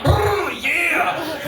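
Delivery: high shouted purr; loud purr. Retold or imitated. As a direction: belt out a mock purr. Seductive purr bit repeated.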